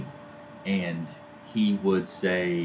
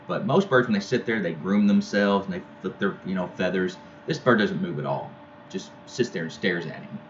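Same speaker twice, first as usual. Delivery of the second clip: normal talking voice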